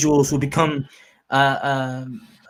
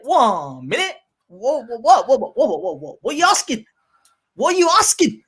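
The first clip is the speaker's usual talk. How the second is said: spluttering what what what, climbing higher; mock outrage; 5 semitones higher than usual. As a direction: stammer in disbelief, building to a higher cry. Imagined reaction to no Christmas.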